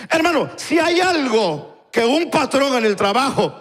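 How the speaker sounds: predicar con fuerza, alto y pausado; predicación enfática. Real, not acted. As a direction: exhortar al hermano con voz firme y elevada. (predicación enfática y pausada)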